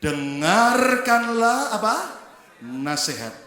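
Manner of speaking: slow, drawn-out reading of the verse; scripture reading. Acted. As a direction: lead the congregation in reading. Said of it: "Yuk baca sama-sama".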